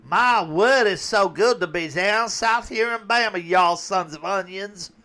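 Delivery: high, loud fake Southern belle accent; mock Southern Hillary. Mocking Hillary pandering in Alabama, fake Southern accent. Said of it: "Hillary was down south going".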